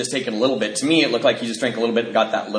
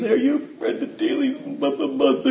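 put-on high, small child's voice; boy asking the mom; little boy; 11 semitones higher, 4 dB quieter than usual. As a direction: ask it like a shy kid.

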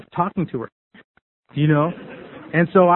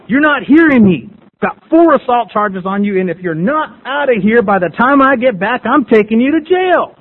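loud, forceful imitated lawman's threat; sheriff's loud ultimatum; 4 semitones higher, 7 dB louder than usual. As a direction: lay down the law, pitch jumping at the end.

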